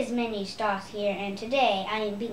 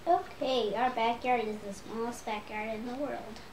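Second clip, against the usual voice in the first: ordinary talk, nothing marked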